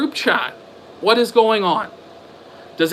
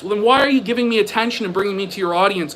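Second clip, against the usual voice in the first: usual speaking voice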